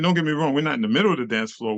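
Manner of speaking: normal talking voice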